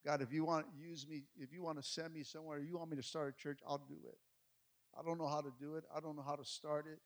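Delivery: quiet prayer. Retold or imitated. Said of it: "I cried out to him and said".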